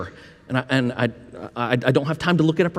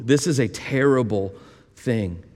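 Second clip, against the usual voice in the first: ordinary talk, nothing marked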